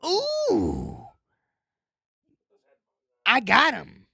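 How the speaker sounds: high, loud delighted cry; startled then thrilled; excited squeal. Retold or imitated. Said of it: "you're like".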